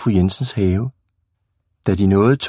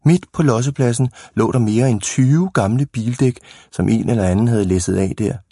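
ordinary delivery

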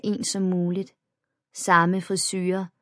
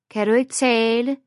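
taunting; 5 semitones higher, 5 dB louder than usual. A narrator's reading. Bully taunts silent narrator.

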